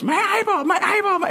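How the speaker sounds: put-on high toddler squeal on repeat; insistent comic kid squeak; Evan. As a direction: pester with a very high little-kid voice. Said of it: "he just goes".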